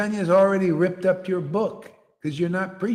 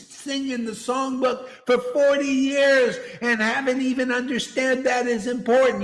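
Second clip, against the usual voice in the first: high, insistent raised voice; impassioned berating; 8 semitones higher than usual. Real, not acted. Preacher berates followers for not understanding, high and impassioned. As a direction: press each question in a high, forceful voice.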